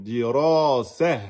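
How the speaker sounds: slow "Dirasah" ending on a resting h; pausal form. Shown as a demonstration. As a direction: drag out "Dirasah", stopping on the h. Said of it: "vous mettez un soukoun".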